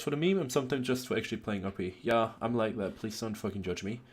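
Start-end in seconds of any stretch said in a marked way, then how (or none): none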